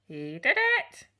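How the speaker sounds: high, loud bird squawk; parrot squawk. Acted. Parrot repeats its phrase.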